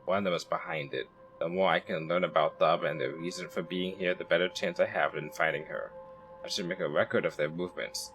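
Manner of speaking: quiet detective's inner thoughts; inner monologue narration. Acted. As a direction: think it through to yourself quietly. Reads character's inner monologue.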